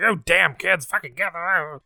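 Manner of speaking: lightly hammed-up cranky father grumbling; grumpy dad. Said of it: "And he's like".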